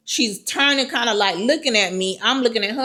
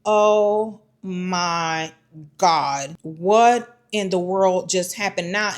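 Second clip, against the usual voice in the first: stunned disbelief; 4 semitones lower, much slower than usual. Retold or imitated. Acts out stunned reaction, trapped.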